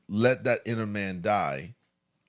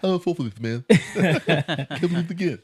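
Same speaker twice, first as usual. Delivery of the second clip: mock bewildered; 5 semitones higher, 4 dB louder than usual. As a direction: laugh it off like a fooled man. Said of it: "Or probably think".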